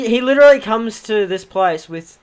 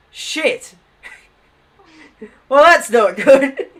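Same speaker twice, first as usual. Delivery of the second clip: laughing dismay; 6 semitones higher, 8 dB louder, slower than usual. Retold or imitated. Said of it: "sobered up halfway through that going".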